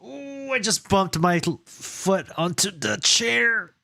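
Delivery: high yelp of real pain; sudden pain. Real, not acted. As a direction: cry out from a stubbed foot. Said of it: "The heel of my foot just got rammed".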